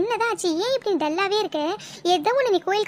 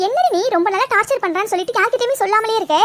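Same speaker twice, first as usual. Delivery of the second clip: normal talking voice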